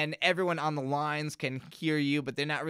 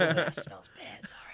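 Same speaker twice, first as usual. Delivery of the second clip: whispering; 2 semitones higher than usual. Real, not acted. Aside right after a burp.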